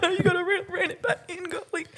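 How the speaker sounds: high, softening, laughing chide; high, fading scold; a hint of a prim scold; mock scolding.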